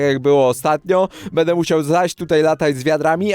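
ordinary delivery

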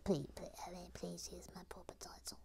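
hushed, fussy little character; whispered character voice. Acted. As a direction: whisper it like a prim puppet. Puppet character speaking in a whisper.

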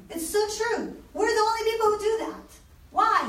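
the professor struck by a new idea; amazed professor. Retold or imitated. Imitating the shocked professor, high and amazed. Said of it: "He's like".

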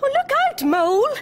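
very high, loud warning cry; alarmed gasp. A narrator's reading. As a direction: shout a startled look-out. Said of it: "gasped the penguins".

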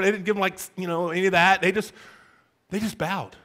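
ordinary talk

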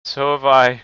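loud eager cry of agreement; loud boyish cry. Acted. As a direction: call it out loud, a boy chiming in. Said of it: "cried Peter".

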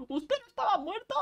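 high, laughing mock disbelief; laughing catchphrase. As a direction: squeal the catchphrase through a laugh. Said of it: "esa es mi, nuestra frase".

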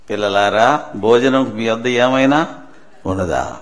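low, tender question to disciples; Jesus. Retold or imitated. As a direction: ask it deep and gently. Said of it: "ఏమంటున్నాడు వాళ్ళని?". (లోతైన, మృదువైన స్వరం)

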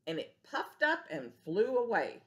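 ordinary delivery, nothing marked

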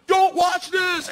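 high, loud, slow yell; shouted warning. Retold or imitated. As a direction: imitate a drawn-out warning over engines. Shouted warning before jumping.

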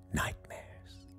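ordinary talk, nothing marked

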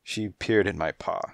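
plain reading voice

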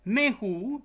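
"Mejú" loud and high, rising; pronunciation demo. Shown as a demonstration. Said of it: "¿Veis la pronunciación?". ("Mejú" fuerte, agudo y ascendente)

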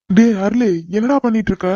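loud, high call across the yard. Acted. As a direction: shout for his brother from a distance. Elder brother calls out to brother. (தம்பியை தூரத்திலிருந்து உரக்க கூப்பிடுதல்)

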